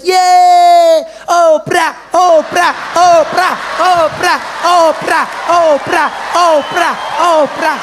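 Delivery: high, loud crowd chant; kids losing their minds. Acted. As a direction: chant the name like frenzied schoolchildren. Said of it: "those kids lost their minds".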